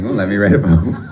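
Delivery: ordinary talk, nothing marked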